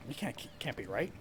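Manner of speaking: hushed unvoiced aside under his breath; whisper to himself. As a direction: whisper the doubt so nobody hears. Muttering doubt to himself.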